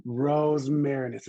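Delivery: Rosmarinus, slow and loud, roz-MAIR-in-us. Shown as a demonstration. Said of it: "we can get through it together".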